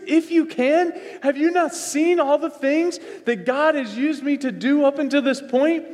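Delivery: high, loud disbelieving Are you serious; Jesus amazed at the doubt, voice up high; incredulous Jesus to the doubting father. Retold or imitated. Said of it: "you could almost hear again Jesus".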